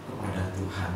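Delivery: ordinary delivery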